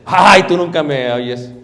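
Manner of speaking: complaining wife. Pretend.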